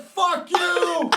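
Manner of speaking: high, drawn-out laughing curse; drunken defiance. Retold or imitated. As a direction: spit it back at the cops. Defying the cops drunk.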